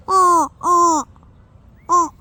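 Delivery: loud goose-like honks; honking voice. Shown as a demonstration. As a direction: honk like a goose. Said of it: "This might sound like a goose".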